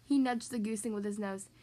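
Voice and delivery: usual speaking voice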